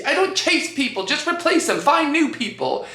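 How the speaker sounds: high, playful imitation of a British friend; hammed-up British accent; bad British accent. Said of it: "I'm doing a terrible accent".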